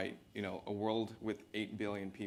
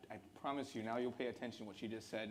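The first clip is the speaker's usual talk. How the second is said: ordinary delivery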